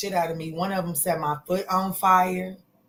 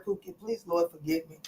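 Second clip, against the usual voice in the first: hushed apology; 8 dB quieter than usual. Real, not acted. Apologizing after cursing.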